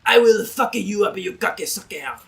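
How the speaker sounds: put-on loud French-accented barbarian rage; super aggressive French. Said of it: "you gotta be super aggressive in French".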